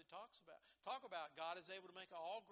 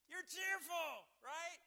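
much higher and louder, like a fan whose team won; mock cheering fan; 8 semitones higher, 9 dB louder, much slower than usual. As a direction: burst out like a fan celebrating a win. Team wins, cheering example.

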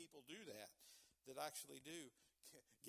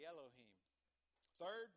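ordinary delivery

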